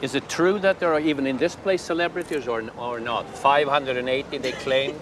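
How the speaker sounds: lightly put-on Swedish accent. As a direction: speak as a hesitant Swedish tourist. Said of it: "I'm from Sweden".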